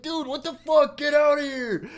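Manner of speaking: high, loud retold yelling; pissed-off roommate. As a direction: act out an angry roommate throwing someone out. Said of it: "Got all pissed off at me".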